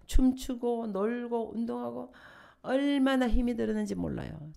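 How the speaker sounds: usual speaking voice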